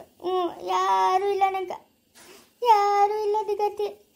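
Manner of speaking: weep at being all alone; plaintive sing-song sobbing; tearful lament. Acted. Weeping over having nobody to turn to.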